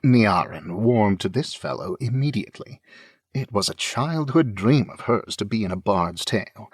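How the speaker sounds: ordinary talk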